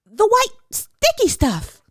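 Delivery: put-on sing-song child ordering lunch; eager little girl. A narrator's reading. Hannah voice continues.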